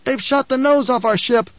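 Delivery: high alarmed call. A narrator's reading. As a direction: call it across the room. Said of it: "someone called".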